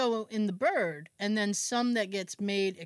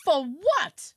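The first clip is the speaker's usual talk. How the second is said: retold outburst, higher and louder; outraged disbelief; 6 semitones higher, 5 dB louder than usual. Mom hears about the garlic, outraged retold outburst. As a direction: burst out as if about to have a stroke. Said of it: "like she's about to have a stroke".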